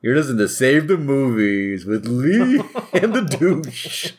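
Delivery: slow, grand show intro; radio announcer. Acted. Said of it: "announcing the show intro".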